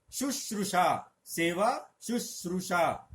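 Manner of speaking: शुश्रूषा drawn out slowly; slow, clear शुश्रूषा; slow careful demo. Shown as a demonstration. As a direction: stretch each sound of शुश्रूषा. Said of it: "इस शब्द पे ध्यान दीजिए".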